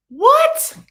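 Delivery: sudden high, loud disbelieving what; shocked what. Real, not acted. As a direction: gasp out a stunned what at the price. Shocked by the price.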